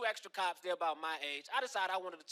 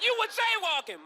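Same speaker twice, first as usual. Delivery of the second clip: much higher, loud cop bark; stern cop shout; 7 dB louder than usual. Retold or imitated.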